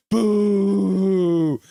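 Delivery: high, loud crying boo; mock wailing boo. Streamer reading a viewer's comment, a loud wailing boo. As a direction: wail it like a sore fan. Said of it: "Captain Canuck's like".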